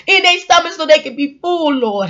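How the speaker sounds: escalating hammed-up prayer, building from plain to high, loud preaching; comic preacher prayer rising to a fervent wail; mock prayer. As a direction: pray over them, building to a shouting cry.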